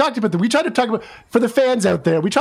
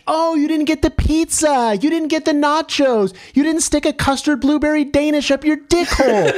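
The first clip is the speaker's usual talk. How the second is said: high, whining mock complaint; whiny complainer; 8 semitones higher than usual. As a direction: whine like a nitpicking fan. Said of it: "some chud whining bitch".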